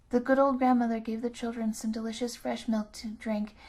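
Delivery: usual speaking voice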